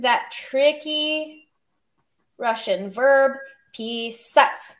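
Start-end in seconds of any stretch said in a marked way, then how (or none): 3.8-4.8 писать with the final syllable punched; stress on second syllable. Shown as a demonstration. Teacher showing learners the verb, писать stressed on its last syllable. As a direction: lean on the -ать in писать. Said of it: "Make sure you stress that syllable".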